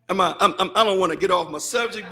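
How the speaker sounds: ordinary talk, nothing marked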